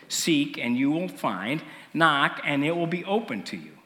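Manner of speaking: usual speaking voice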